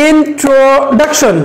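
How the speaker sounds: "introduction" said very slowly in three parts; slow choral lead; slow model for repeating. Shown as a demonstration. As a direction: lead the class, slow and clear. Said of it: "Do with me".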